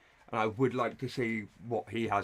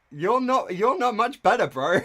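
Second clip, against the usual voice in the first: much higher and louder, scoffing; incredulous call-out; 9 semitones higher, 7 dB louder than usual. Real, not acted. Streamer telling the boyfriend he's no better, loud and scoffing. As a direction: call him out in disbelief.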